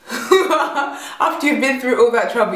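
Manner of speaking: loud, slow and laughing in disbelief. Real, not acted. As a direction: laugh it out at how absurd it was. Laughing at the memory.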